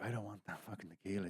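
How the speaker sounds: soft, secretive plea; covert hint. Retold or imitated. Trying to signal the bartender past Chuck, covert hint. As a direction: slip it to her under the breath. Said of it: "in code".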